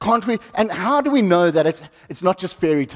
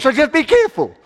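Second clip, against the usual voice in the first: mock worried parent; 7 semitones higher, 3 dB louder than usual. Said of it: "did you say, Tony, to your family".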